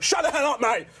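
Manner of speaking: high, loud retold snap at a heckler; snapping at a heckler. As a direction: bark it at the punter. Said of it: "And I went".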